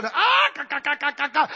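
high clucking imitation; hammed-up chicken cluck; chicken squawk. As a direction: cackle like a barnyard chicken. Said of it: "That old chicken will say".

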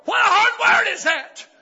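high, full-force shouted question; preacher's outcry. Real, not acted. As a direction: cry it out to the congregation. Preacher exclaims over scripture.

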